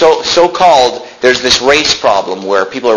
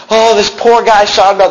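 higher, louder mock lament; mocking pity; 9 semitones higher, 4 dB louder than usual. Preacher mocking leaders' pity for Sean Bell, high mock lament. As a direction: overdo the sorrow. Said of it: "they say".

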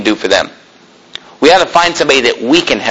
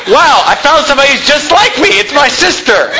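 a higher, amused voice of a dating-site user discovering a match; mock dating-site user; 10 semitones higher than usual. Preacher mocks an online dater finding his sister, amused high voice. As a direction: play the delighted online dater, half laughing. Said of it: "It's like".

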